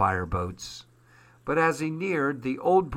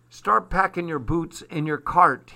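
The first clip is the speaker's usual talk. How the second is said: raised, brisk-spirited command; giving orders; 5 semitones higher, 4 dB louder than usual. A narrator's reading. Armand telling children to pack, mildly commanding. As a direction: order it firmly, a little higher. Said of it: "he ordered".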